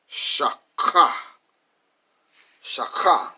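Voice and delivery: shaka, broken into SHA and a rasped KHA; teacher sounding out "shaka"; pronouncing the Hebrew. Shown as a demonstration. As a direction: say "shaka" loudly, syllable by syllable. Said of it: "we're gonna pronounce the characters".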